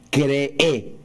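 cree with stress on the final E; acento en la e final. Retold or imitated. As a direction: stretch cree onto a stressed last E: creÉ. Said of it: "con acento en la E final". (cree con acento agudo en la última e)

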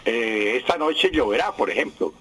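ordinary talk, nothing marked

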